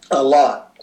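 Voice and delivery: losing voice, raspy and worn; rough, strained voice through a cough; hoarse voice. Real, not acted. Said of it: "I'm losing my voice".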